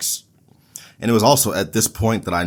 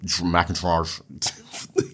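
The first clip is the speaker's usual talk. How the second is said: slowed name; 3 semitones lower, 5 dB quieter, much slower than usual. Shown as a demonstration. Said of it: "easy for me to say".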